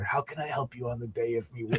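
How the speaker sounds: low, hushed, lightly done mafia don voice; Godfather impression. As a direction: murmur it like a quiet mob boss offering favors. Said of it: "like The Godfather".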